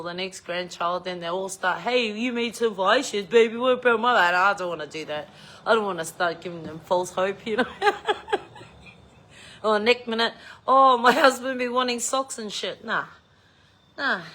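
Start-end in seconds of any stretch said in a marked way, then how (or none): 1.8-4.3 put-on higher, louder wheedling kid voice; louder sing-song plea from a grandkid; mock begging grandkid. Grandkids begging for crocheted gifts. 10.7-12.8 higher, louder entitled ask; pushy in-law voice, raised pitch; mock demanding relative. Relative asking for socks.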